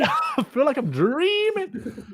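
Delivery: a drawn-out, high-pitched parody of his mate; mock dreamy echo. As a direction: echo the friend's words, high and very slow, teasing. Echoes friend's line mockingly.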